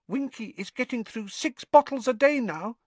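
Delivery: high elf voice, hushed; whispering elf. A narrator's reading. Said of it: "Dobby whispered to Harry".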